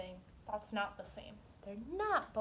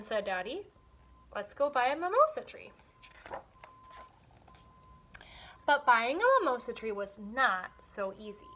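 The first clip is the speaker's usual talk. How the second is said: lively storybook reading with voiced characters; story read aloud; 6 dB louder than usual. Teacher reading a picture book to young students, animated. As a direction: read it to the class with expression.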